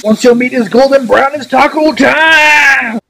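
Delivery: high, loud, stretched hype call; excited announcement. Pretend. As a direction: belt it like a game-show host. Announcing taco time.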